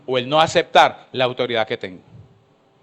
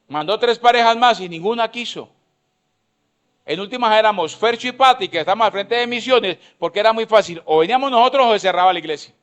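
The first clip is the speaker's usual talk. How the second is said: fervent sermon at full voice; full-force preaching; 5 semitones higher than usual. Acted. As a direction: drive the point home to the congregation. Preaching again at high pitch.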